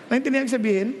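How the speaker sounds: usual speaking voice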